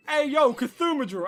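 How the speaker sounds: loud, high, slow teasing call; teacher's mocking greeting. Voicing the history teacher.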